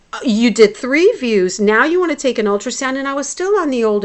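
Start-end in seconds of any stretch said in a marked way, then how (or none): 0.1-3.0 retold challenge, loud and quick; indignant protest. Patient arguing with staff over yet another scan, indignant. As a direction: push back in exasperation, pitch jumping up.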